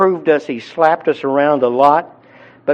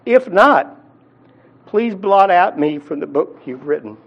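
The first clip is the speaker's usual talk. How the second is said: imitated pleading; 3 semitones higher than usual. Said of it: "crying out to God".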